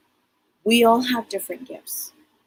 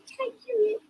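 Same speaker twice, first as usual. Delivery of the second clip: much higher, quieter teasing; sing-song it like a small child; child spirit playing tag; playful little girl; 4 dB quieter than usual.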